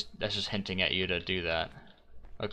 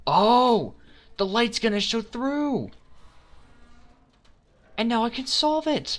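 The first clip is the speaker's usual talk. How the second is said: much higher and louder, thrilled; excited breakthrough; 6 dB louder than usual. Real, not acted. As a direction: shout it out as the puzzle clicks. Puzzle gamer cracks the solution.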